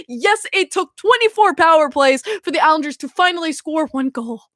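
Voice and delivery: usual speaking voice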